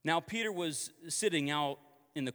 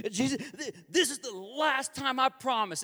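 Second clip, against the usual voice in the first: high, loud, pleading promise; earnest vow; 8 semitones higher, 5 dB louder than usual. Acted. Believer promising Jesus one last time, fervently. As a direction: swear it fervently, pitched right up.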